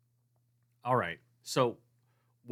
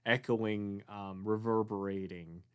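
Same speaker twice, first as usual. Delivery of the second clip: ordinary talk, nothing marked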